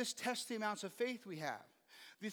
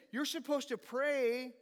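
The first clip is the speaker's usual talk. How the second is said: usual speaking voice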